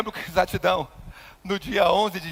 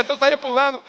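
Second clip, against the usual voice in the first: normal talking voice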